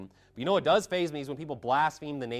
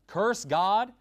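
sharp raised cry on curse God; loud outburst; 8 semitones higher, 7 dB louder than usual. Acted. Wife urging Job, sharp loud cry. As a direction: fling it out as a challenge. Wife's words read from Job.

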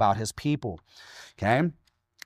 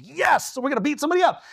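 put-on eager kids; 10 semitones higher, faster than usual. Teens eager to fight, retold.

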